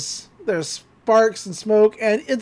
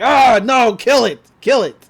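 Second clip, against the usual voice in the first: loud frantic cry, a touch higher; panicked yell; 3 semitones higher, 8 dB louder than usual. Retold or imitated. Stan bitten by old Goldie, yelling in panic. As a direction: yell as if the machine is biting your hand.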